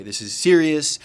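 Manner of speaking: loud, high, scolding outburst; tirade. Retold or imitated. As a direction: explode at him over the seriousness. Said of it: "go off again on kind of a bit of a tirade".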